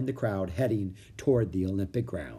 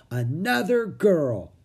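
put-on higher, drawn-out girl's voice; Annie's voice; 6 semitones higher, 4 dB louder, much slower than usual. A narrator's reading. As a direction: stretch it out, pleased. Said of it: "she said".

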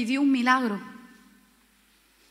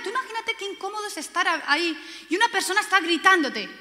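ordinary delivery

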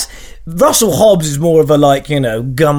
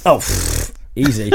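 ordinary talk, nothing marked

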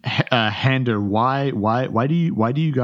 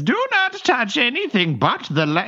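prim bank teller; 14 semitones higher than usual. Acted. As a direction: play the overly polite teller, pitched up. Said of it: "and he says".

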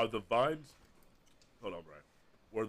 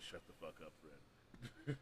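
quiet; 6 semitones lower, 18 dB quieter, much slower than usual. Shown as a demonstration. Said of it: "the theme of this season".

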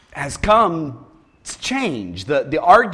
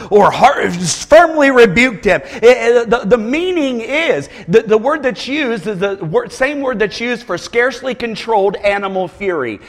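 ordinary talk, nothing marked

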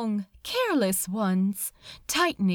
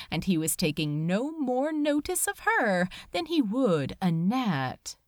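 usual speaking voice

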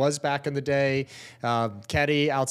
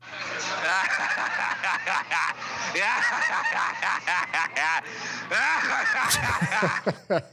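evil chuckle, drawn out; villain laugh; 5 semitones higher than usual. Acted. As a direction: cackle like a movie villain. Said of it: "You make a great villain laugh".

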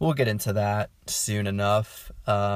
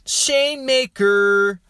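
"Shame Maker" sung very high, loud and slow in a nasal pop-punk whine; [name] voice; 10 semitones higher, 6 dB louder, much slower than usual. Retold or imitated. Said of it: "the Blink one eighty-two voice".

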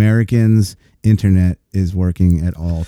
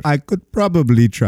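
higher-pitched put-on Indian accent; 5 semitones higher than usual. Friend tries out the agent's voice, mock Indian accent. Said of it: "doing the Indian voice".

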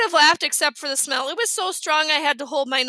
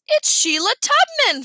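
put-on excited child; 10 semitones higher, 6 dB louder than usual. Excited little boy announcing.

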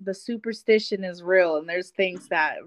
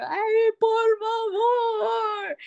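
high, very slow crying plea; imitating a sleep-talker; 11 semitones higher, much slower than usual. Mother-in-law moaning for help at night, high slow cry. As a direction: stretch the plea out like a moan. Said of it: "And he could hear".

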